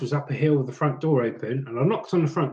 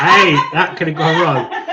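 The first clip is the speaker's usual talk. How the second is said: loud laugh through the words; 2 semitones higher, 8 dB louder than usual. Real, not acted. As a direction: laugh off a near slip, loudly. Laughs off his slip of tongue.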